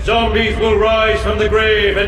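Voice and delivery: loud, gloating alien threat over a broadcast; booming evil broadcast. Acted. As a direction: boom it out like a villain taking over the airwaves.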